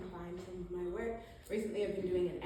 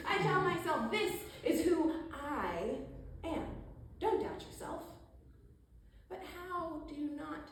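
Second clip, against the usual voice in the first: raised, insistent self-talk with stretched words; emphatic spoken word; 8 semitones higher than usual. Acted. Poet telling self not to doubt, higher emphatic recital. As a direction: pitch it up and press each phrase.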